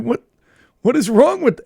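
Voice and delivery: ordinary talk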